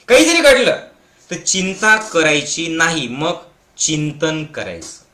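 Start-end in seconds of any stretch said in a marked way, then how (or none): 0.1-0.8 high, loud burst of preaching; sudden loud emphatic challenge; emphatic outburst. Acted. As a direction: throw the line out hard to the crowd.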